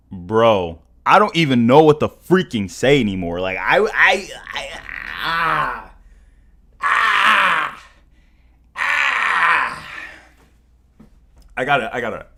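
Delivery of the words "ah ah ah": repeated drawn-out cries, climbing higher; overwhelmed cries. Real, not acted. Streamer lost for words, drawn-out rising cries. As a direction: cry out wordlessly, again and again, rising.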